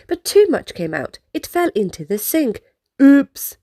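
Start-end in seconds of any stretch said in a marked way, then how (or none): 3.0-3.6 louder startled oops; yelled oops. A narrator's reading. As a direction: blurt it out as the toothpaste falls. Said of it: "Scout yelled".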